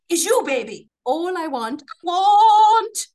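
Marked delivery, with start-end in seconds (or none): none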